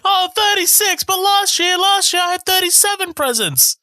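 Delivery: very high-pitched spoiled brat whine; high mock tantrum; Dudley impression. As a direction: squeal it like an indignant spoiled child. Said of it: "are you fucking Dudley from Harry Potter".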